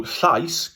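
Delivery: "llais" with a breathy Welsh ll and an "eye" vowel; Welsh I sound. Shown as a demonstration. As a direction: say "llais" to rhyme with "ice" after the ll. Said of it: "the I sound".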